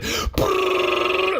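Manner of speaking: high buzzing brrr sound; imitated tub rumble. Tub shaking the house, high mock buzz. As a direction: buzz like a rattling jacuzzi motor.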